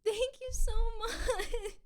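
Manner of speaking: teary thank you; choked up, slow and thankful; crying. Real, not acted. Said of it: "I'm crying".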